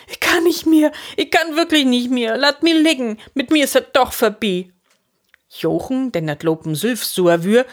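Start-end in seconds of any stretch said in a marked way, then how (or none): 0.1-4.7 high, exhausted moan of giving up. A narrator's reading. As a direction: wail that you can't go on. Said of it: "Hein wird düchtig in Schweit kamen und stöhnte". (hooch stöhnen, heel af)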